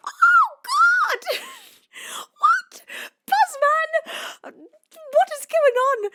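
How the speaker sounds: very high, loud shriek of surprise; excited scream. Real, not acted. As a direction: scream with shock and joy. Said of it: "every time I'm screaming there".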